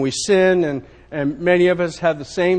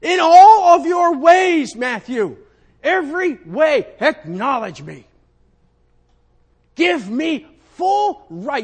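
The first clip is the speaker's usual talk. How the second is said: high, loud, slow proclamation; God's voice; 10 semitones higher, 7 dB louder, slower than usual. Acted. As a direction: command it like God himself.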